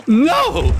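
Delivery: high shouted refusal; stubborn shout. Acted. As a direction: yell it back defiantly.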